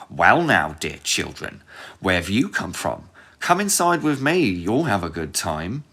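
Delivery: put-on sly old witch, coaxing. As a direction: lure them in with a witch's sweetness. Old witch luring children sweetly.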